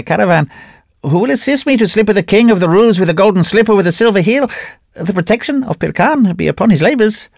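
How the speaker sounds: high, fast prophet's rant in a changed voice; ranting mad priest. Acted. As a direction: rave at the bazaar like a holy fool.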